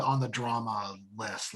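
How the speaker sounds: normal talking voice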